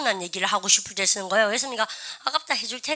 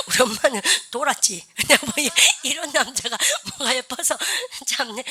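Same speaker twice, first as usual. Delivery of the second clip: real laughter breaking up the words; laughing while talking. Real, not acted. Teacher joking to students about his parents, talking through laughter. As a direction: chuckle through the joke.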